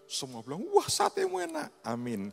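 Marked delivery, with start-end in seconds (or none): 0.7-1.8 very high, loud delight; delighted praise. Retold or imitated. Classmates praising his satay, very high and delighted. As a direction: gush over the satay.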